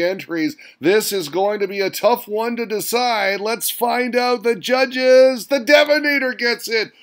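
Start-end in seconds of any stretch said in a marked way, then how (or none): 3.4-5.5 slow, drawn-out, higher teaser; suspense build. Real, not acted. Host teasing the judges' verdict, slow and drawn out. 5.5-6.9 higher, louder triumphant announcing; excited winner call. Real, not acted. Host cheering the American winner, loud and higher.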